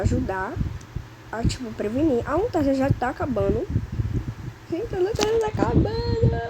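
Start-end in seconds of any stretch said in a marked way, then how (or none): 4.7-6.4 alarmed. Real, not acted. Flashlight running out.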